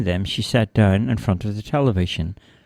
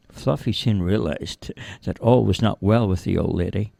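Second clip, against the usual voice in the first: plain reading voice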